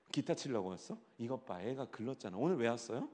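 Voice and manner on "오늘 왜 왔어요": ordinary delivery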